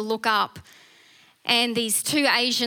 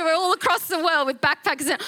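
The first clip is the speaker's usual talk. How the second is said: normal talking voice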